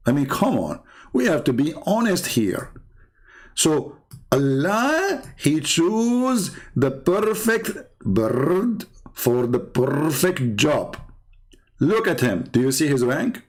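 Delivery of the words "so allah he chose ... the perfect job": mock solemn. Mock solemn praise of bird.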